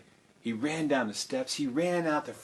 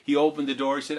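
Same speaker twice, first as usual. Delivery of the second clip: plain reading voice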